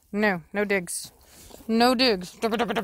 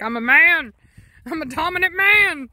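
mock dog voice; 8 semitones higher, 7 dB louder than usual. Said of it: "He's like".

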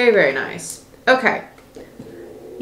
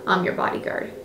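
hushed, softer reassurance; reassuring whisper; 8 dB quieter than usual. Retold or imitated. Adult self soothing the frightened inner child, whispered. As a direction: whisper comfort to a scared child. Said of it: "And you'll be like".

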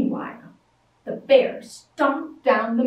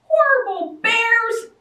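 higher, loud angry shout; angry refrain; 8 semitones higher, 8 dB louder than usual. A narrator's reading. Upset girl reading Goldilocks, loud angry cry. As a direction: snap it out in frustration.

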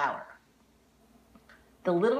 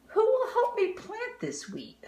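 hen voice. Acted. Hen asks friends, high voice.

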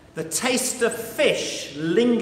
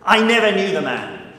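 loud desperate denial; 7 dB louder than usual. Acted. Peter denies in panic.

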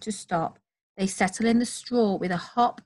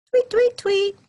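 loud high chirpy tweets; high birdsong tweets; bird tweets; 14 semitones higher, 7 dB louder than usual. Pretend. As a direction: chirp out brightly. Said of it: "That's how they reply".

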